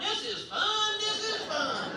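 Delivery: put-on very high, drawn-out kid squeal; hollering little brother. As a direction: imitate a small boy squealing on a ride. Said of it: "my little brother is just hollering".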